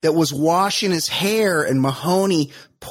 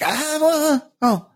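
lightly voiced Sven, jumping much higher; a hint of a fussy Swiss guy, pitched way up; Swiss bodybuilder Sven; 10 semitones higher than usual. Swiss bodybuilder hesitating to Kobe, lightly voiced high. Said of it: "Sven's like".

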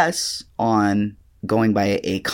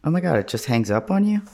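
high, fast disbelief; can't believe it hung up; surprised; 8 semitones higher, faster than usual. Real, not acted. Caller hung up on.

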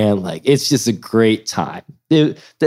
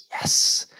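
unvoiced little victory whisper; sneaking past the guard, a whispered yes; 7 dB quieter than usual. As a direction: whisper it so the guard can't hear. Whispered celebration passing a guard.

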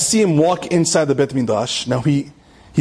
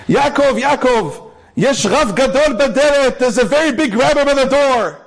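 high, louder calling across the house; mother calling out; 7 semitones higher, 3 dB louder than usual. Retold or imitated. Mother calling her son to the door, raised and high. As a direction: call him urgently from the door. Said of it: "My mother screams".

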